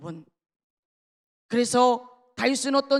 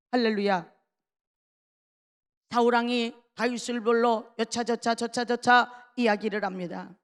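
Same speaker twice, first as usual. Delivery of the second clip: ordinary talk, nothing marked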